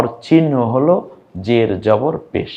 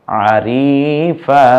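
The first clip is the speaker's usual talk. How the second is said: drawn-out blending; 3 semitones higher, 4 dB louder, much slower than usual. Shown as a demonstration. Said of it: "এটা কিন্তু আমি টানি নেই".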